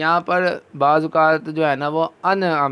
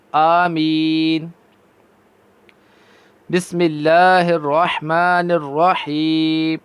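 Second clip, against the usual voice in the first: slow, drawn-out melodic tajweed chant; Quran recitation; 4 dB louder, much slower than usual. Shown as a demonstration. Teacher reciting Quran for students. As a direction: recite each verse long and measured.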